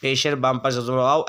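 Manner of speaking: ordinary delivery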